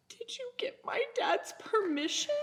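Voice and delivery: high, squeaky question; small high voice, reenacting disbelief; retold anxious blurt. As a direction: squeak it out, half laughing.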